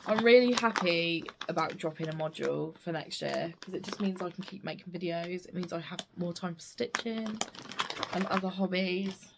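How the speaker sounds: normal talking voice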